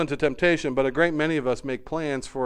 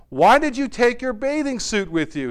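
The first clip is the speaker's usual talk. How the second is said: higher, louder puzzled demand; questioning father; 8 semitones higher, 6 dB louder than usual. A narrator's reading. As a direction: press your son for a reason. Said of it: "he questioned".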